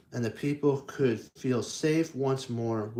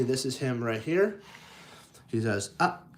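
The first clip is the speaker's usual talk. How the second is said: ordinary delivery, nothing marked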